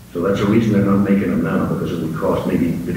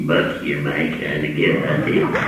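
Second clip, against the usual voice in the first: lightly voiced little robot, low; slow, stuttering robot catchphrase; Tweaky; 4 semitones lower, much slower than usual. Comedian demonstrating the little robot he voices, robot voice. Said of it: "I'm the voice for that".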